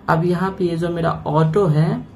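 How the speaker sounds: normal talking voice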